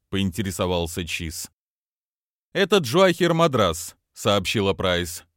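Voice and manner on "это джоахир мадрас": cartoon princess. Price voice.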